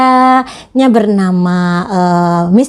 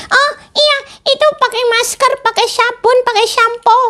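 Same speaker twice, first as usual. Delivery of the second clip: put-on much higher childlike puppet voice; puppet friend; 13 semitones higher than usual. Teacher voicing her puppet for the children, high puppet voice. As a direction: play the cheeky puppet sidekick, pitched way up. Puppet lists hygiene items.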